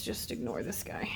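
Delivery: near-whispered, barely voiced; hushed aside. Real, not acted. Teacher skipping part of the page, hushed aside. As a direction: drop to a whispery mumble.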